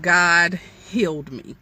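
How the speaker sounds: normal talking voice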